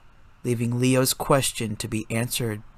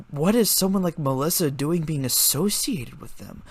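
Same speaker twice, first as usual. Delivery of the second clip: plain reading voice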